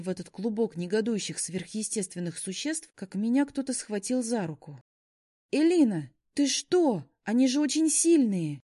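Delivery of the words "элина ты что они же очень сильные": higher, louder alarmed cry; urgent worried warning. A narrator's reading. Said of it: "встревоженный голос Алиши".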